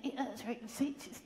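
ordinary talk

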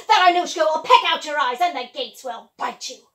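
loud menacing witch voice; threatening witch. Acted. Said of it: "she wiped her mouth and said".

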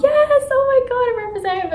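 put-on girl squealing to mom that she got signed, thrilled; squealing joy. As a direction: shriek the good news.

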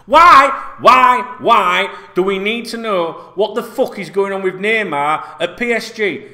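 loud, slow, exasperated why, why, why; exasperated outburst. Real, not acted. Vlogger ranting at the news feed, loud and exasperated. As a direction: vent your frustration at the pointless story. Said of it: "exasperated at the story".

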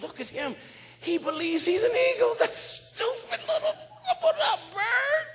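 high, rising jeering voices; mocking onlookers. The farmers mocking the flying bird. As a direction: sneer at him laughing.

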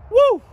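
high, loud "woo"; excited whoop. Real, not acted. Excited whoop over the tortoise.

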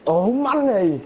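frustrated. Real, not acted. Frustration in game.

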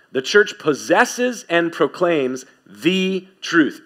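slow, with weight on "the truth"; measured, slow "the truth" with the stressed. Shown as a demonstration. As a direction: lean on "the truth" as the one and only. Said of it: "I'm emphasizing the words the truth".

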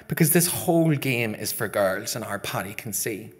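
usual speaking voice

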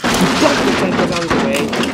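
loud, excited storytelling; dramatic narration. A narrator's reading. Narrating Nigel's rescue push, loud excited narration. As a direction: hit the action line hard.

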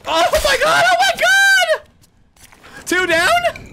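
very high laughing yell; ecstatic shout. Real, not acted. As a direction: scream with disbelieving joy. Shock at surprise kill.

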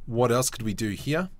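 usual speaking voice

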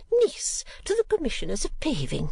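high-pitched haughty repetition; high, indignant disbelief; affronted scorn. Acted. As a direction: repeat it as an insulted lady. Lady scornfully repeats the title.

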